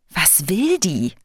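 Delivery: slow, suspicious question; disdainful wondering. A narrator's reading. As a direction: draw it out, put off by the stranger. Heart's wary, scornful thought.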